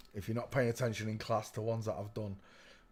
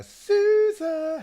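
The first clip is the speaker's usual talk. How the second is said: silly drawn-out high voice; 11 dB louder than usual. Pretend. Drawn-out name greeting.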